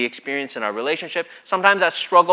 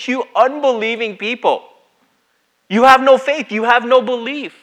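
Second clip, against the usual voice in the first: calling out; 8 semitones higher, 7 dB louder than usual. Retold or imitated. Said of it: "he's calling out his disciples and saying".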